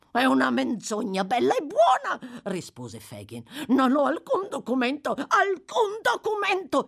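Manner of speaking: high, loud, crying denial; a terrified old man lying. Acted. (negazione acuta e disperata)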